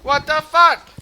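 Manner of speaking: high, loud incredulous yelling; outraged disbelief. Real, not acted. Player facing a game setback, outraged disbelief. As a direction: yell it in sheer disbelief.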